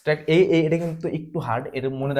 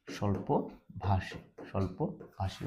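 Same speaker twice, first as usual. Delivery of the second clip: স্বল্পভাষী softly, very slowly, in separated pieces; slow dictation; 6 semitones lower, 11 dB quieter, much slower than usual. Shown as a demonstration. Teacher repeating a meaning for students, slow soft dictation. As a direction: break স্বল্পভাষী into slow, quiet syllables.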